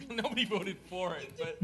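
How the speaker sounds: real chuckle breaking into the words; amused. Real, not acted. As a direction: laugh while you say it. Chair amused by a carried vote.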